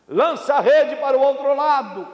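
high, carrying command; calling out an order. Acted. Jesus directs the fishermen from shore, calling out. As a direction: call the instruction across the water.

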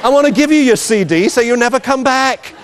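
high, laughing mock plea; eager judge. Judge to Malamute owner he wants gone, high mock eagerness.

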